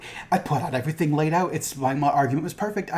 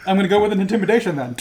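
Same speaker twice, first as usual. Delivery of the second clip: fast, louder through laughter; laughing; 6 semitones higher, 7 dB louder, faster than usual. Retold or imitated. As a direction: rush it out while cracking up. Laughing player switching tactics.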